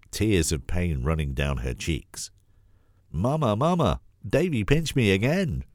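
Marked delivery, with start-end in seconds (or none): 3.1-5.6 put-on high, wailing little girl; sobbing child. A narrator's reading. Little girl running to grandma, high tearful wail. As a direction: cry out to grandma like a hurt child. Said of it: "Sophie sobbed".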